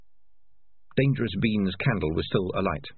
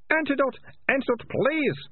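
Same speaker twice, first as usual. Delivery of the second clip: high, desperate begging; moaning plea. A narrator's reading. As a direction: wail for help at the top of the pitch range. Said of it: "moaned the Ratcatcher two".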